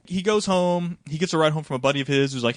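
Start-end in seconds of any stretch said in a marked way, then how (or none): none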